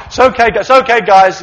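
loud, hurried reassurance; loud calming. Pretend. Disciple calming the others, loud reassurance. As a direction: shout to calm the crowd.

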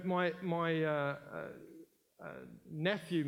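ordinary delivery

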